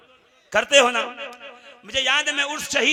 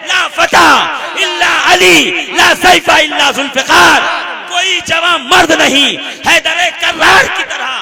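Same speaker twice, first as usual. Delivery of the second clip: loud, driving sermon oratory; full-force preaching; 2 semitones higher, 9 dB louder than usual. Acted. Preacher tells the congregation of Ali in battle, impassioned declamation. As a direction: build the battle story to a shouted climax.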